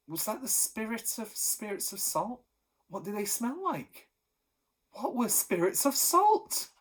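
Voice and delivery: a mild touch of alarm; anxious wondering. A narrator's reading. Thunderbolt wondering about the smell, mildly anxious. Boy's anxious inner questions.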